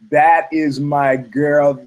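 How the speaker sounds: very slow, words stuck like straw in the throat; choking out words. Retold or imitated. Claiming the girl at the table, he forces it out very slowly. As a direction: force it out past a lump in the throat. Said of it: "I go".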